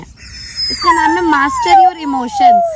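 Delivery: very high, slow and loud; high drawn-out announcement. Acted. Telling the dog the book's name, very high and slow. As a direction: stretch the title out high and slow.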